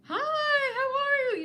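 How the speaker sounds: high, slow, loud gushing hello; overjoyed slow hello; gushing greeting. Retold or imitated. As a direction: greet her like a long-lost friend, drawn out. And I'm like.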